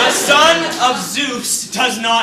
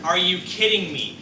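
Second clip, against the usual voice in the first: usual speaking voice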